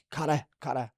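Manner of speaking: imitating a call to a teammate. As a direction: call to someone who hasn't heard you. Calling teammate who cannot hear.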